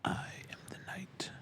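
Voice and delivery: soft, whispered dark avenger; Batman impersonation. Acted. Hobbyist performing Batman for his friends, whispered impersonation. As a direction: whisper it as a brooding vigilante. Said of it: "your Batman impersonation".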